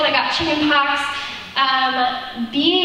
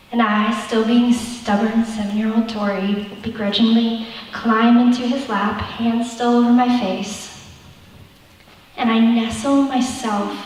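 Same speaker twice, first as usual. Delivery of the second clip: normal talking voice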